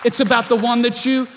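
full-force preaching climb. Acted. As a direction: preach it with growing fire. Building preached exhortation.

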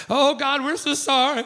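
loud, high begging for forgiveness; hammed-up desperate apology; mock repentant pleading. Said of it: "they're repenting".

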